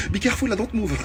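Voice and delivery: soft, laughing plea; giggling mock panic; laughing while pleading. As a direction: laugh through the panicked answer. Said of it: "Je dis, Oula!".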